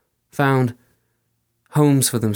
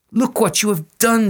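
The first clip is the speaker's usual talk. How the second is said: higher, louder scolding cry; bleeding woman scolding; 10 semitones higher, 4 dB louder than usual. Acted. Fairy woman rebukes the soldier, high and louder. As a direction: accuse him of the wound. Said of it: "she said".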